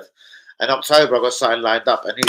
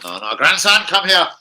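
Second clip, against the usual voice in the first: a hint of a grandad calling his grandson, higher and louder; mock grandad calling; 11 semitones higher, 8 dB louder, slower than usual. Dodging an ex in McDonald's, calling his grandson in a mock grandad voice. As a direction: call out like a pretend doting grandad. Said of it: "I was literally turning the other way like".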